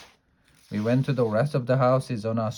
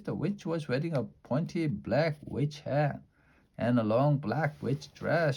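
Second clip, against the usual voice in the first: ordinary delivery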